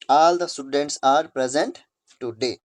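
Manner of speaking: slow, word by word; careful classroom reading pace; slow dictation read. Shown as a demonstration. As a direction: read the sentence out slowly again.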